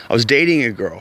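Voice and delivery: ordinary delivery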